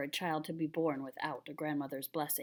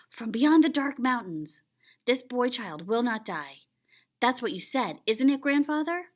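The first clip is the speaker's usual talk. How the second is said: excited; 6 semitones higher, 8 dB louder than usual. Acted. Excited boy retelling.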